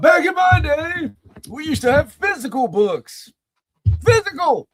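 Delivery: put-on high, slow creaky old man; crotchety old timer complaining. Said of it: "that's my old man voice".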